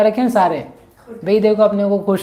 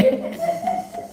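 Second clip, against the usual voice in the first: laugh; 6 dB quieter than usual. Real, not acted. Preacher laughs at queen's errand.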